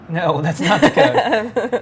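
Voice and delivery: laughing at his own slip. Real, not acted. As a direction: chuckle through the correction. Flubs the discount code.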